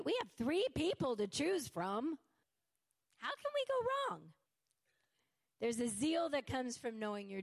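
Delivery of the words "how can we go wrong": mock naive. Mock naive rhetorical question.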